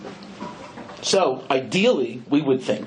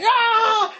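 high startled scream; panicked scream; 3 dB louder than usual. Retold or imitated. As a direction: yelp as the mob charges. Driver flees the mob.

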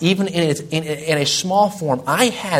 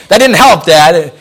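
annoyed retort; 3 semitones higher, 10 dB louder, slower than usual. Retold or imitated. Son retorting to father.

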